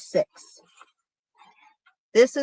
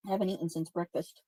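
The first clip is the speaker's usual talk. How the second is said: chewing while talking; 2 semitones lower, 12 dB quieter, faster than usual. Real, not acted. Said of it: "I'm sorry for my chewing".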